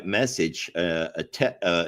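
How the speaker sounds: normal talking voice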